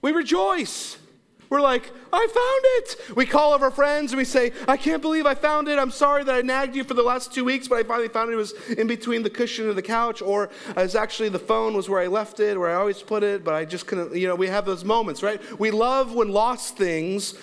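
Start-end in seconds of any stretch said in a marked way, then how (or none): 2.1-3.2 high, slow triumphant cry; gleeful I found it; excited discovery. Retold or imitated. Excited finder calling out. 4.7-14.1 higher, fast gushing apology; breathless I found it call; excited relief. Retold or imitated. Voicing phone call to friends.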